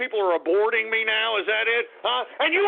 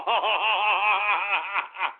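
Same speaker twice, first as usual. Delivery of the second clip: long rolling laugh; hearty laugh; 6 semitones lower than usual. Real, not acted. Laughs after drinking.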